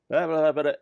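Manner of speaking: high, loud gibberish blabber; mock babbling. Rambler acting out a brain fart, high mock babbling.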